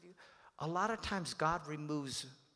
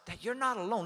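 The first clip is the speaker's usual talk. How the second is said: ordinary talk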